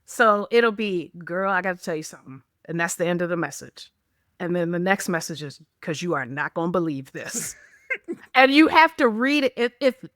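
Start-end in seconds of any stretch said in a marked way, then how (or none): 1.2-2.4 low, quick, confidential teaser; dramatic text message. Retold or imitated. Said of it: "it's inserting drama". 5.8-7.6 low, hushed cliffhanger; dramatic text message. Retold or imitated. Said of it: "it's inserting drama".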